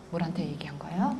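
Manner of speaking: soft unvoiced whisper; hushed whisper to the audience. Real, not acted. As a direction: whisper it like a secret. Whispered aside.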